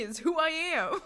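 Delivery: laugh-crying. Real, not acted. Mock despair laughing.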